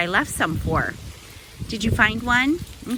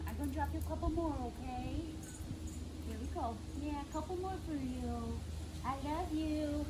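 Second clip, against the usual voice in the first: much softer, cooing voice to a squirrel; soft pet talk; 6 semitones higher, 18 dB quieter than usual. Real, not acted. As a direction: coo gently at a beloved little animal.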